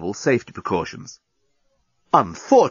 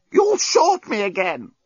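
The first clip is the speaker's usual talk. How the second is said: higher, slow strangled plea; choked; 9 semitones higher, slower than usual. A narrator's reading. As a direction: still being throttled. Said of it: "choked the despot".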